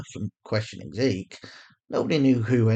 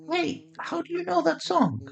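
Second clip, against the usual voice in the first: surprised question. Acted. Narrator voices surprised Sanaya.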